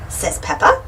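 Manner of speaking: ordinary delivery, nothing marked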